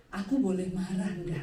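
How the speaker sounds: a hint of a gentle Javanese husband; polite husband asking. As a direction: ask meekly for permission to be angry. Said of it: "Suami saya itu kalau marah".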